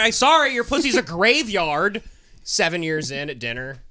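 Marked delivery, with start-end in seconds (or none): 0.0-2.0 mocking husband voice. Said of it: "You go".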